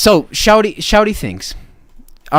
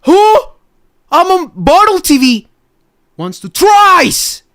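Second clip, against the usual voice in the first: much higher, louder mock squeal; 14 semitones higher, 4 dB louder than usual. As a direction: squeal it in disbelief. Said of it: "I'm gonna be like".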